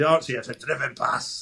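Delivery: quick impression of a Dundee coach's accent. Shown as a demonstration. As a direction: slip lightly into a Dundee accent. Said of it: "that's my Dundee accent".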